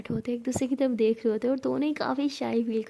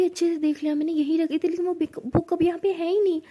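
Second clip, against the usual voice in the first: high, raised, frustrated voice; agitated insistence; 5 semitones higher, 4 dB louder than usual. A narrator's reading. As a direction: insist it's gone, pitch climbing in alarm. Insists the book is gone. (झुंझलाई, घबराई आवाज़)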